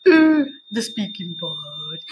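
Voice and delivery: low, drawn-out worried groan; mock nervous student; nervous dread. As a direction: act the dread of the exam. Nervous student dreading speaking exam.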